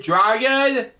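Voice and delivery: usual speaking voice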